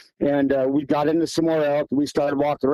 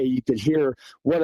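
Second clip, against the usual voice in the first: ordinary talk, nothing marked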